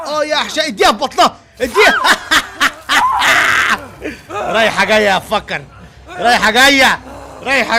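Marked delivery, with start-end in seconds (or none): 2.0-4.5 real shouted laughter. Real, not acted. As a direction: crack up mid-fight. Laughing while fighting.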